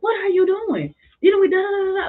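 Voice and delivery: high, loud mock yell; mock coach yelling. Harsh coach yells at player, high loud mock yell. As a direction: blast the player like an angry coach.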